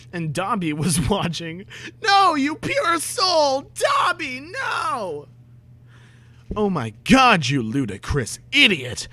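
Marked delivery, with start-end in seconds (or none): 2.0-5.3 very high, slow, shouted no; anguished shriek. Real, not acted. Horrified cry about Dobby watching. 6.6-9.1 a loud outburst on oh my God that drops back down; hammed-up rage at the intruder; indignant shout; mock angry outburst. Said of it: "they both shooted angrily".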